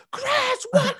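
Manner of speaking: very high-pitched imitated shriek; mock mom scream, way up high. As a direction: shriek it like an outraged mother.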